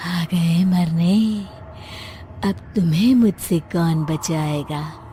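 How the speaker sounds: slow, low menacing ghost voice; ghost threat. Acted. Ghost bride threatens the neighbours, slow and menacing. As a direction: loom over them, drawn out and menacing.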